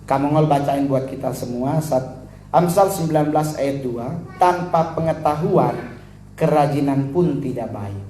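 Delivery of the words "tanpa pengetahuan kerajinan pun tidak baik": scripture reading. Acted. Membacakan ayat Alkitab.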